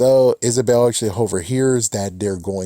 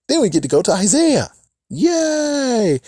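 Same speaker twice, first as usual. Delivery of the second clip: mock cheer; 14 semitones higher, 5 dB louder than usual. Real, not acted. Mock cheer for the next scene.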